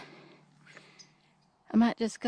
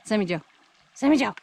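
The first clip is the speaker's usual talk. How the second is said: calling out; 5 dB louder, much faster than usual. Real, not acted. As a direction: call the dog sharply from a distance. Calling the dog out of mud.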